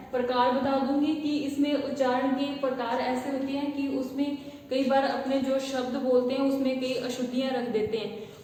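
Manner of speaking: ordinary talk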